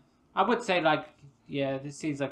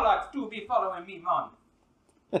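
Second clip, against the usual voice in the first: put-on loud, higher Jamaican accent; 7 semitones higher, 6 dB louder than usual. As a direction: complain loudly in Jamaican patois.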